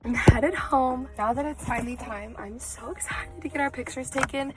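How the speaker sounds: ordinary talk, nothing marked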